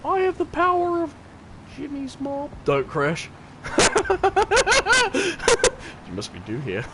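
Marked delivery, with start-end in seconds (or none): 0.0-2.5 high-pitched hero's proclamation, dropping softer at the end; hammed-up, high grandiose boast; mock-epic boast. Mock-epic boast while playing. 3.6-6.0 long high-pitched giggling cackle; staccato, high laughter. Real, not acted. Laughs during the game.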